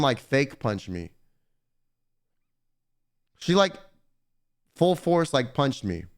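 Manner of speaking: ordinary talk, nothing marked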